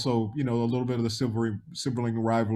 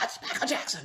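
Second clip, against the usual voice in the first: starstruck fangirl; 9 semitones higher, 10 dB quieter than usual. Retold or imitated. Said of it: "like some little girls".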